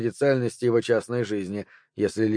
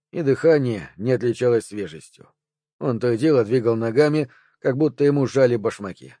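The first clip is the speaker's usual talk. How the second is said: ordinary delivery, nothing marked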